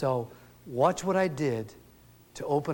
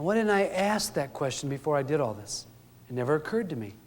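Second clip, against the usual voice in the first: ordinary delivery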